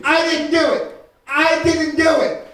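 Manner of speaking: slow, loud whiny protest; mock kid protest. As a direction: drag out the kid's denial. Kid argues with parent.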